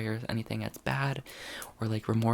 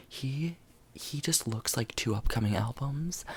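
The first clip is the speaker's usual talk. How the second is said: normal talking voice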